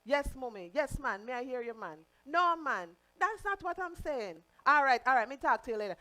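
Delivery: put-on lively Jamaican accent on the phone with mom. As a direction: talk to mom the island way, in Jamaican patois. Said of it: "I'll start out in one accent and then my mom will call".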